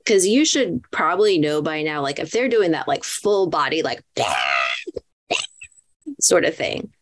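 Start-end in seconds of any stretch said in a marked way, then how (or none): 4.1-5.0 put-on squeaky baby pterodactyl screech; pterodactyl shriek. Shown as a demonstration. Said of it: "that was a really annoying sound".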